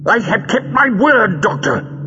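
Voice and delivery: high, strained impatient villain; impatient villain's protest. A narrator's reading. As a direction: insist impatiently, pitched right up. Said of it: "said Greal impatiently".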